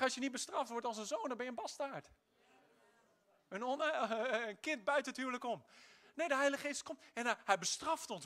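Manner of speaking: ordinary talk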